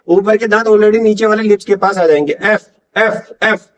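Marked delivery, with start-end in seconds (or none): 2.3-3.7 "एफ" drawn out slowly as a hiss through the teeth; teacher hisses a slow "एफ"; slow F demo. Shown as a demonstration. Teacher slowly showing students the F sound. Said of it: "आप बोल के देखिए".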